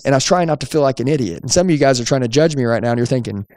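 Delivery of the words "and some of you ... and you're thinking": ordinary talk, nothing marked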